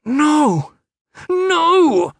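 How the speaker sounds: very high, loud cries of refusal; desperate shout. A narrator's reading. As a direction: scream in desperate protest. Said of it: "Aram shouted".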